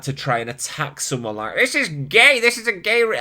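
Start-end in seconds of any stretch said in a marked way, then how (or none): 1.5-3.2 higher-pitched mock jeer; mocking a hater. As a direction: mimic a petty online attacker, pitched up. Mimicking a hater typing an attack.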